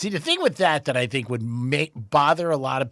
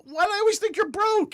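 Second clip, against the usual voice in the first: incredulous question; 4 dB louder than usual. Retold or imitated. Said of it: "I go".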